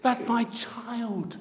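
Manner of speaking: high, loud and drawn-out pride; proud father savouring every word; excited. Acted. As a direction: exclaim it slowly, full of delight. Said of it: "our God is so excited".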